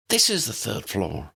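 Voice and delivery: put-on light girl's voice, matter-of-fact; Tally correcting. A narrator's reading. Tally corrects Harry on the stairs, lightly matter-of-fact. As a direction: set him straight plainly. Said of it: "Tally told him".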